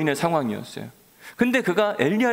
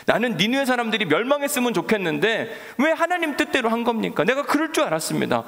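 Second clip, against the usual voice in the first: mock sulky anger; 8 semitones higher than usual. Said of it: "요나가 화가 났어요".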